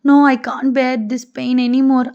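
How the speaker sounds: ordinary delivery, nothing marked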